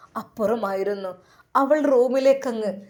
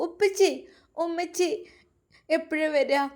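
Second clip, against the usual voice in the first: put-on higher, small child's voice; little boy; 6 semitones higher than usual. A narrator's reading. Little son asking his father when mother comes, childlike. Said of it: "നവാസിനോട് പൊന്നുമോൻ ചോദിച്ചുകൊണ്ടിരിക്കുന്നു".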